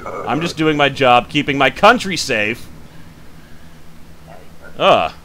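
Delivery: usual speaking voice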